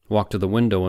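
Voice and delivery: ordinary delivery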